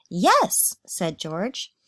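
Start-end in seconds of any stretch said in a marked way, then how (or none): 0.1-0.8 higher, louder yes; eager yes. Acted. George answers mom, eager. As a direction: say it bursting with certainty.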